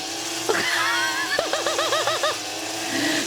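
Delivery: high shrieking cackle; shrieking laugh. Real, not acted. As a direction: let out a piercing laugh. Cackling at chat.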